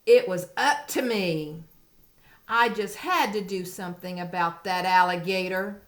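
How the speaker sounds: storybook read-aloud, lively; reading to a toddler. Acted. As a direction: read it like a picture book. Reading picture book aloud.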